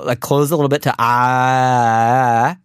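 "ah" with the mouth a bit closed; slightly closed ah. Shown as a demonstration. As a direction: close the jaw a little on "ah". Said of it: "close your mouth a little bit".

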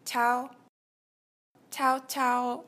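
ordinary talk, nothing marked

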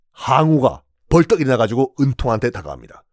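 usual speaking voice

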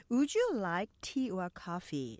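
slow model sentence. Shown as a demonstration. Teacher models sentence slowly.

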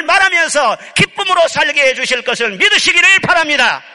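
loud, fervent preaching; preaching at full force. Acted. Pastor exhorts the congregation in a loud, fervent preaching voice. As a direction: proclaim it to the whole congregation.

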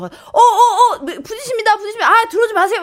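high, panicky warning; mock alarm. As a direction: re-enact fending off a car about to bump. Retold near-collision, warning driver. (부딪힐까 다급하게 말리는 흉내)

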